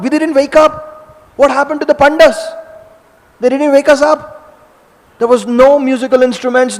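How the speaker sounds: high, loud panicked questions; urgent, startled fuss; alarmed waking. Acted. Balaram wakes alarmed.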